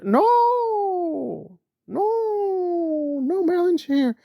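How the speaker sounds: high, pleading falsetto; hammed-up high mock plea; mock whiny Aaron. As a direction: whine it like a caught man. Mocking Aaron hiding behind Marilyn.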